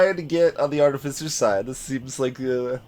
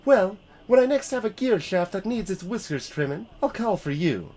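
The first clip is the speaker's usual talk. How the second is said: higher scornful artificer; 7 semitones higher than usual. Shown as a demonstration. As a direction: pitch it up and scoff. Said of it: "higher voice is what I want there".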